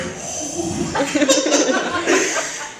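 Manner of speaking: high squeaky uneasy laugh; high nervous laugh. Retold or imitated. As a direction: laugh out of pure awkwardness. Nervous reaction to massage.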